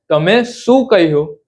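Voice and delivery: normal talking voice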